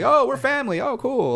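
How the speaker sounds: mock delighted locals. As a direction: squeal it like discovering cousins. Locals discovering kinship, acted.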